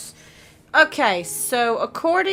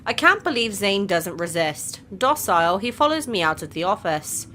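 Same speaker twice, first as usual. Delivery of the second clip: ordinary delivery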